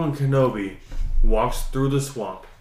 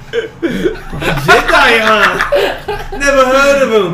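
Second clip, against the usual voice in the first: higher and louder, laughing Shrek scoff; 10 semitones higher, 9 dB louder, slower than usual. Acted. Shrek brushes off Obi-Wan, laughing ogre voice. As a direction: scoff at the word Jedi like an ogre. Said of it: "And then Shrek said".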